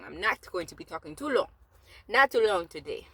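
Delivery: playful, badly faked Jamaican accent; mock Jamaican accent. Vlogger promising viewers a short talk, mock Jamaican accent. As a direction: put on a silly, wobbly Jamaican accent. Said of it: "That's me doing a, a whack accent".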